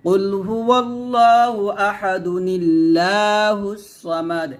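কুল হুয়াল্লাহু আহাদুনিল্লাহুস joined with noon qutni, chanted slowly; slow recitation. Shown as a demonstration. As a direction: recite it all in one breath like tilawat. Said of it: "এরকমভাবে পড়তে হবে". (মিলিয়ে ধীরে তিলাওয়াত)

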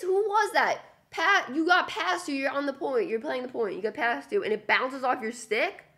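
real exasperated ranting; incredulous rant. Real, not acted. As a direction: berate the player in disbelief. Ranting at the player.

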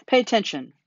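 urgent yell. A narrator's reading. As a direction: yell for his attention. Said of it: "screamed Wilbur".

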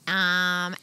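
ordinary delivery

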